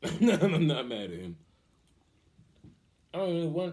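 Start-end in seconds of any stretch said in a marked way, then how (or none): 0.0-1.4 slow and louder, laughing through it. Real, not acted. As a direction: laugh it out slowly. Repeated with a laugh.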